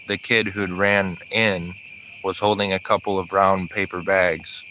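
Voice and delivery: ordinary delivery, nothing marked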